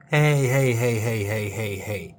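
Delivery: hey hey, starting loud and fading down; loud calling out. Acted. Comic host calling loudly to a daydreaming viewer. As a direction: call out to snap them out of it.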